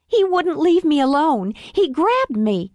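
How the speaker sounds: high, pleading fake-tearful woman; fake victim plea. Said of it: "blinking until her eyes filled".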